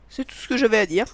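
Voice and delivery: usual speaking voice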